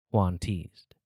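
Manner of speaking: plain reading voice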